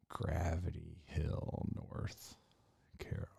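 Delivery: very soft, slow murmur to yourself; barely voiced, absent-minded search mutter; muttering while searching. Real, not acted. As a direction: mumble it under your breath, as if typing it in. Typing a web search to himself.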